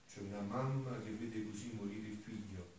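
usual speaking voice

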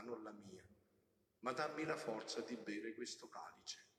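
soft, low voice pleading to a father; intimate prayer. Acted. Voices Jesus praying to his father.

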